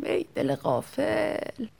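soft slow dismay; rueful sigh to self. Acted. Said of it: "با خودم گفتم".

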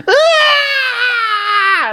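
long, high-pitched imitated shriek; shrieking scream. Wrestler retelling a broken finger backstage, imitated shriek. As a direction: mimic a drawn-out scream of pain. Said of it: "it's just this shrieking scream".

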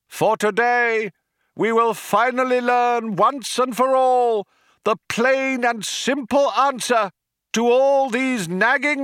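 rally the crowd over a tannoy; crying out to crowd. A narrator's reading. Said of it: "cried the man".

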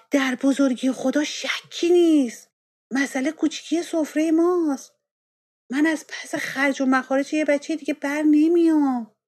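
husband shouting his anger at the wife; angry shout. Acted. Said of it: "با عصبانیت فریاد زد". (فریاد خشمگین)

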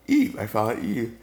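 ordinary talk, nothing marked

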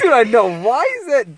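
high, loud excited outburst. Real, not acted. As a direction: blurt it out in surprise. Reacting to something on screen.

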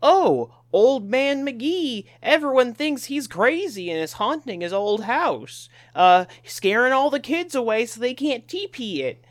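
high, slow cartoon sleuth voice; mocking cartoon voice. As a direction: ham up a cheesy cartoon mystery. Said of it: "the biggest thing the mystery gang ever solved was".

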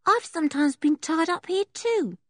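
put-on high, matter-of-fact young boy; a scruffy little boy talking plainly; high boyish voice for Sniffer; traveller boy voice.

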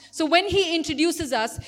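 usual speaking voice

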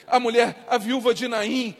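ordinary talk

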